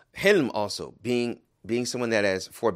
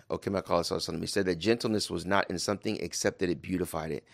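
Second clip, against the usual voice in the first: usual speaking voice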